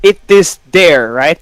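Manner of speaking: ordinary delivery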